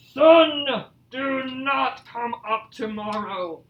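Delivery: slow, booming story-bear command; loud commanding Bear. Acted. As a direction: call out SUN loudly, then draw out the order slowly. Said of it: "spoke in a loud".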